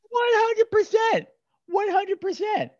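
very high, slow, drawn-out shouted agreement; emphatic agreement. Real, not acted. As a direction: stretch it out and belt it in total agreement.

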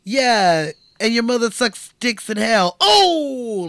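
mock a foul-mouthed eighties comic's punchline; Dice Clay impression. Host doing Andrew Dice Clay's catchphrase, brash and loud. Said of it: "That's what he would say".

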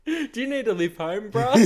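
a light mock of a mate asking. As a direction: pitch it up like a smug mate. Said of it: "He go".

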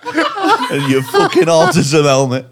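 mock insults through laughter; giggling through the jibes; laughing insults. Real, not acted. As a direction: hurl insults while cracking up.

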